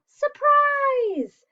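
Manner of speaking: high, loud party shout; yell surprise. Acted. Friends greet Bear at his den, loud shout. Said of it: "His friends yell".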